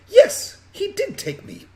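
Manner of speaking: put-on indignant child, starting loud and high; ostentatious young girl. Crossed-armed girl accusing her kidnapper, high and indignant. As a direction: insist on it like an affronted little girl. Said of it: "she looks arms crossed".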